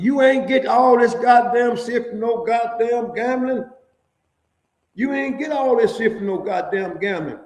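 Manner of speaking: high, loud put-on guard voice; mocking officer. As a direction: sneer at the gambling excuse as the officer. Officer mocking his gambling claim.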